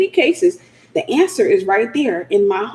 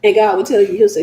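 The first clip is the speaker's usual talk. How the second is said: ordinary talk, nothing marked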